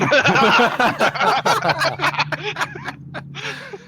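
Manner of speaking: real laugh. Real, not acted. Player cracks up at his own startled bit, real laughing. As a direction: burst out laughing and keep it going.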